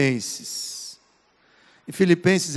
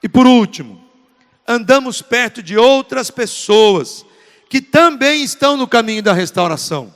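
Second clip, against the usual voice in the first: loud, emphatic sermon point; forceful preaching; 7 dB louder than usual. Real, not acted. Preacher introducing his final point on restoration, forceful preaching. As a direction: proclaim the last point to the congregation.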